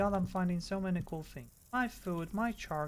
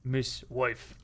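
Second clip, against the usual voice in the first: low monkey-meme voice in broken speech; 6 semitones lower, much slower than usual. Acted. Friend quoting the wife monkey meme, low put-on voice. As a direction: play a simple monkey thinking aloud. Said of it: "the wife monkey meme".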